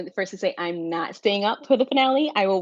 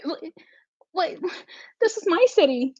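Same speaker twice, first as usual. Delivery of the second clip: mock indignant Belly; 9 semitones higher than usual. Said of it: "And Belly's like".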